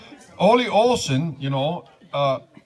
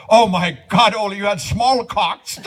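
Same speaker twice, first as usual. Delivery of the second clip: higher, louder shocked gasp of a new bride; shocked Lena; 7 semitones higher, 6 dB louder than usual. Acted. New wife shocked at Ole's smallpox, louder and higher. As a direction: bride reels back at her husband's body, shocked and loud.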